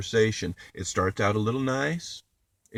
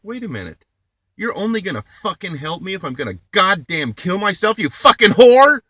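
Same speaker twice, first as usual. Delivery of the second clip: higher, louder and louder until screaming; furious rant building to a scream; 9 semitones higher, 11 dB louder than usual. Real, not acted. Ranting at sister over the phone, building into a scream. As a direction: let the fury build to a full scream.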